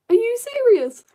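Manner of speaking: high, loud incredulous outburst; real exasperated squawk; exasperated disbelief. Real, not acted. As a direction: cry out in disbelief at your own mistake. Exclaims at failing to tell colours apart.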